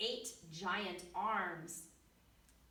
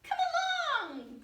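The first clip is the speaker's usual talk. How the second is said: much higher, louder floaty call; high jellyfish call; 9 dB louder than usual. Acted. Jellyfish calling to the fish, high and bright. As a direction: sing out the greeting up high.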